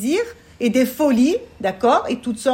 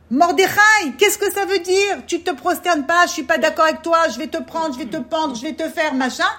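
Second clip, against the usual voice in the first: loud, higher, indignant threats; Haman's angry outburst; 4 semitones higher, 4 dB louder than usual. Retold or imitated. Teacher voicing Haman threatening Mordechai, angry and loud. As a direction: bark the threats like a proud offended man. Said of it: "au lieu de dire".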